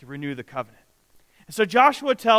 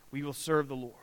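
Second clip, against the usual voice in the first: whispered pledge; 4 semitones lower, 8 dB quieter, faster than usual. Acted. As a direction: breathe the vow in a whisper. Joshua's pledge, spoken softly.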